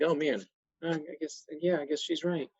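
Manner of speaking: soft, halting mock admission; reluctant concession. Husband concedes that his wife is right, soft and reluctant. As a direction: give in quietly and haltingly, as if beaten.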